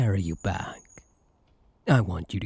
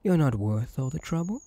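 ordinary talk, nothing marked

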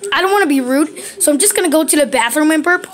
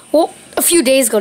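usual speaking voice

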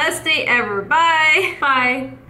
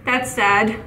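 ordinary talk, nothing marked

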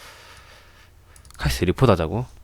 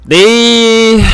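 loud; 10 semitones higher, 7 dB louder, much slower than usual. Real, not acted. Sudden loud word mid stream.

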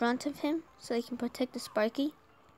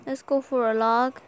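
ordinary talk, nothing marked

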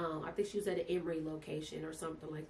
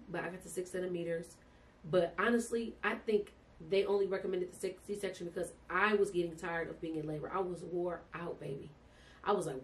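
normal talking voice

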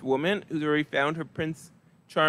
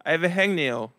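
ordinary talk

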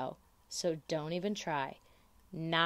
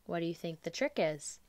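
ordinary delivery, nothing marked

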